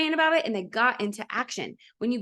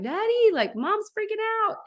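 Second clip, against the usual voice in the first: little son; 11 semitones higher, slower than usual. Retold or imitated. Son voiced in retold story.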